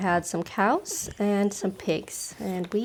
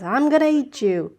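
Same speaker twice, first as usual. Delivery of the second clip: loud threatening troll voice, pitched up; the troll threatening the little goat, loud and menacing; 5 semitones higher, 4 dB louder than usual. A narrator's reading. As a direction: growl it out like a troll under the bridge. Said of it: "and the troll says".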